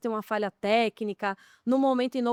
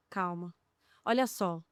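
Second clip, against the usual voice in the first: softer, lower and calm; 5 semitones lower, 6 dB quieter than usual. Retold or imitated. Producer calming a panicked crew member, softer and lower. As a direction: bring the voice down to calm a stressed person. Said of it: "vou abaixando o tom".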